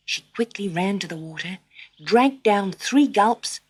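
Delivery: ordinary delivery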